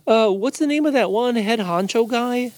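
high, bouncy cartoon bear; eager goofy bear; cheerful bear voice. Bobo voice.

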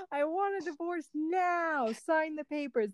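slow, playful mock demand; hammed-up divorce demand; mock yell. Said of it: "she'll just yell".

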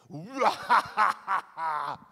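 loud, high gloating laugh; gleeful laugh. Acted. Joseph enjoying the trick on his brothers, loud gloating laugh. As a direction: cackle with glee at the payback.

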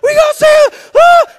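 high, full-volume panicked screaming; mock freak-out. Kids scrambling to rescue a goldfish, hammed-up freak-out. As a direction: imitate kids frantically screaming. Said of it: "they're freaking out".

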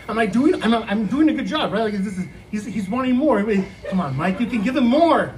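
ordinary talk, nothing marked

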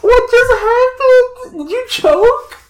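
high, loud, laughing disbelief. Real, not acted. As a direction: ask through laughter. Laughing at choking cohost.

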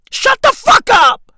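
loud, very high-pitched shout; exasperated yell. Acted. Comedian telling Putin to stop talking, exasperated yell. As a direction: scream it at him in mock outrage.

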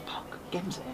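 high, hushed whisper; starstruck whisper. Retold or imitated. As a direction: whisper it so he can't hear. Starstruck, hero at next table.

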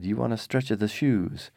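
hammed-up Italian-accented shopkeeper; fake Italian accent. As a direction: put on an unconvincing Italian accent for the cobbler. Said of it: "fake Italian accent because I can't do".

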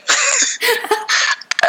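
high squealing laugh; laughing while retelling a prank. Real, not acted. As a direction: let out a high-pitched laugh.

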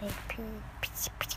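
fast soft whispered chatter. Real, not acted. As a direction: rattle it off in a whisper. Rapid whispered babble.

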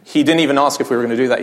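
ordinary delivery

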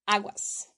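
aguas said short as a warning; aguas as an expression. Shown as a demonstration. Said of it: "Miren, es así".

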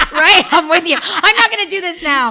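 loud, high, laughing outburst; laughing mock refusal. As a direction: play the refusal big through laughter. Joking along about backing out of birth.

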